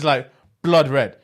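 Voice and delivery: ordinary talk, nothing marked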